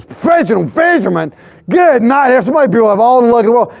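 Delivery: exaggerated disbelief, then rushing on in envy; mock incredulity. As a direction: imitate a stranger's astonished scoff, rising high. Retold stranger's incredulous reaction to answer.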